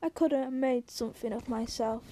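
plain reading voice

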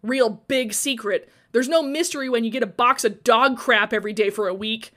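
loud, bitter sarcasm; sarcastic and sore. A narrator's reading. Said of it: "J T said bitterly".

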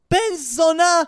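high, strained curse in a put-on voice; mock outburst. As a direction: spit it out in mock fury. Frustrated outburst at the bank. (קללה מתוסכלת בקול גבוה ומעושה)

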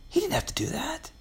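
hushed, breathless whisper of awe; awed whisper. Real, not acted. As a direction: drop to a whisper, amazed. Awed aside after "Wow.

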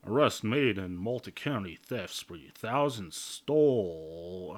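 loud, drawn-out headline announcing; mock news read. Podcaster reading today's news to listeners, mock anchor delivery. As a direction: announce it like a newscaster, slowing right down.

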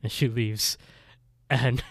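ordinary delivery

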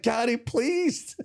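high, drawn-out mock pleading; imitated begging. Pals begging him, acted out.

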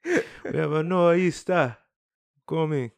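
high, loud, slow Jamaican weatherman; Jamaican accent. Pretend. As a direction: announce the storm in a Jamaican accent. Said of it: "talk in a Jamaican accent".

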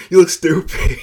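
real laughter breaking into a mocking remark; cracking up while talking; laughing. Real, not acted. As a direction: laugh through the words, high and loud. Laughing at the man's swagger.

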